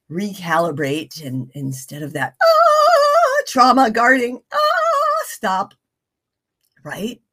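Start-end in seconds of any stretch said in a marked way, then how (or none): 2.4-3.4 sudden high yelp of pain. Retold or imitated. Imitating a pain reflex. 4.5-5.2 sudden high yelp of pain. Retold or imitated. Imitating a pain reflex.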